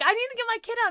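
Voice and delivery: high, urgent plea for help; panicked plea. Retold or imitated. Mother begging employee to free her trapped son, high panicked plea. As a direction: beg the employee, pitch jumping up. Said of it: "I'm like".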